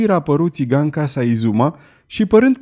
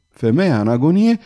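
ordinary delivery, nothing marked

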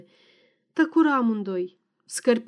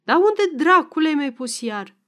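put-on higher, louder cranky old man; irritated snap; 5 semitones higher, 5 dB louder than usual. A narrator's reading. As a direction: bark it at the wife, fed up. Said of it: "se necăji și se răsti la femeie".

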